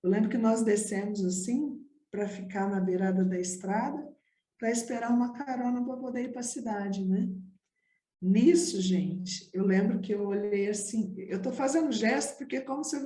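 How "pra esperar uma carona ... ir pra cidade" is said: ordinary delivery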